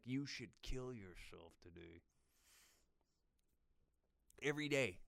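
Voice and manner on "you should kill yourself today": low, soft and grim; a hint of a bleak inner voice; dark mirror self-talk. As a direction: mutter it at your own reflection. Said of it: "look in the mirror and be like".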